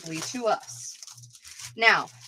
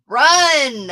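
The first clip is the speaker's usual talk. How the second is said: loud, higher mock warning; drawn-out, punched playful yell; playful call-out; 4 semitones higher, 10 dB louder than usual. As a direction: holler it out in play. Mock urging after crowd picks B.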